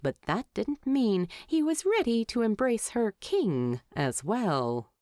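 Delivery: plain reading voice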